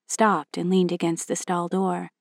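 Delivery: plain reading voice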